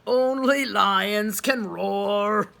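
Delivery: very slow, loud lion voice. A narrator's reading. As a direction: boom it out like a proud lion. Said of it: "the lion speaks".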